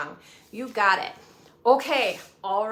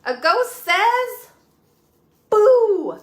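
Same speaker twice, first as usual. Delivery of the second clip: high, loud drawn-out ghost Boo; spooky ghost boo; 9 semitones higher, 9 dB louder, much slower than usual. Acted. Teacher acting a ghost for kids, loud playful boo. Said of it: "what does a ghost say".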